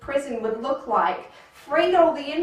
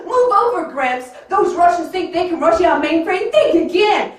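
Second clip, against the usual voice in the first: higher, louder brash hacker voice; loud showy movie-hacker bravado; cocky hacker kid; 6 semitones higher, 9 dB louder than usual. Acted. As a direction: play the cool movie hacker cutting in. Hacker kid taking over keyboard.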